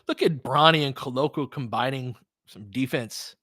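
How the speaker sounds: normal talking voice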